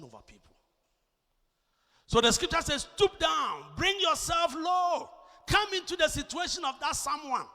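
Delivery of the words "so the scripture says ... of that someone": loud, high pulpit exhortation; raised, emphatic preacher's voice; full-force preaching. Real, not acted. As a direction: call it out to the congregation.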